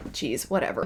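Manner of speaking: soft, dismissive brush-off; mock unimpressed. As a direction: drop the volume, thoroughly underwhelmed. Unimpressed reaction to haughty villains.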